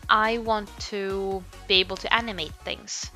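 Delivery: ordinary talk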